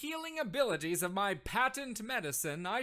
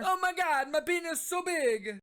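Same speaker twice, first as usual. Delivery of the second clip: high, loud mock ecstatic shout; overacted rapture; 8 semitones higher, 7 dB louder than usual. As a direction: gush like a cured miracle case. Plant overacting cure.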